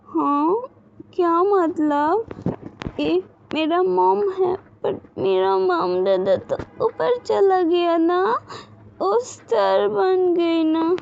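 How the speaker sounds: put-on high, slow tearful little girl's voice; a small child confused and weeping; crying child. A narrator's reading. Little Wing tells Gaga her parents became stars, high and tearful.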